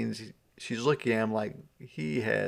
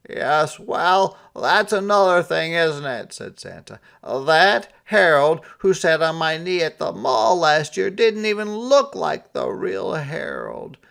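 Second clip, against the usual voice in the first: high, loud put-on Santa voice; a sighing, higher Santa; 7 semitones higher, 7 dB louder than usual. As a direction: play him sulky and fed up.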